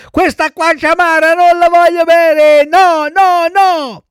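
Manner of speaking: put-on shrieked childish tantrum, very high and loud; tantrum outburst. A narrator's reading. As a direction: wail it out like a bratty child. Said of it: "dando in uno scoppio di pianto". (strillo capriccioso e piagnucoloso)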